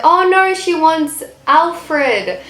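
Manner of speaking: high, drawn-out teasing quote; mock dismay. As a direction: imitate a waitress's playful "oh no", stretching the end. Waitress teasing, retold.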